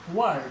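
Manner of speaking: वर्ड said with no r sound; वर्ड, the r left silent; word without r. Shown as a demonstration. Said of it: "आर का उच्चारण नहीं होगा". (वर्ड बिना र के)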